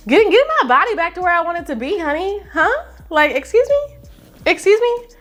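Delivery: high, fast, sassy "honey"; sassy diva attitude. Real, not acted. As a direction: throw shade at yourself, diva style. Sassy aside about body goals.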